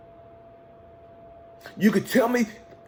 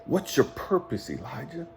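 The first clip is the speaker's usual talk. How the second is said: quoted mentor; 11 dB quieter than usual. Retold or imitated. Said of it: "He said".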